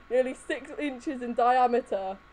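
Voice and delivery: usual speaking voice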